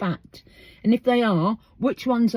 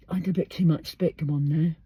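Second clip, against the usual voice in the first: ordinary delivery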